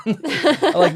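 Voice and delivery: laughing through the words. Real, not acted. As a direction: giggle it out. Laughing while speaking.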